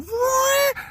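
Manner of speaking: very high, loud zooming noise; squealing vroom sound effect; imitated falling rig. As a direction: mimic machinery whizzing downward. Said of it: "it's going".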